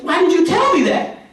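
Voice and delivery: indignant disbelief. Retold or imitated. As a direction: demand it like she was tricked. Dorothy feels tricked.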